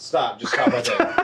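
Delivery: loud laughing protest. Real, not acted. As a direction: laugh while cutting him off. Laughing at the announcer bit.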